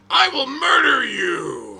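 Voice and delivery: slow, very loud, high yell; sudden furious shout. Retold or imitated. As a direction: explode into a screamed threat. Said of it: "He will go from answering questions to".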